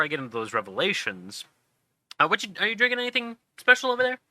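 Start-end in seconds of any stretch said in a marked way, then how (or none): none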